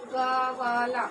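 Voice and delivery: ग्वाला drawn out as three slow syllables, ga-waa-laa; split pronunciation. Shown as a demonstration. Teacher showing how a written word reads, split pronunciation. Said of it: "परंतु इसका उच्चारण क्या होगा".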